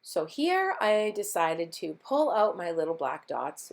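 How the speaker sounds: ordinary talk, nothing marked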